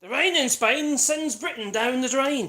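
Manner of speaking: slow, loud, high posh declaiming; elocution parody. Pretend. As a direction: declaim like a mock elocution lesson.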